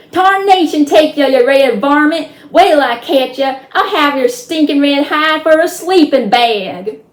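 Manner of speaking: high, loud, raging cowboy hollering; tiny cowboy roaring at his enemy, high and loud; furious cowboy shout. Acted. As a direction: bellow threats like an enraged little cowpoke. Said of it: "stood up in his stirrups and shouted".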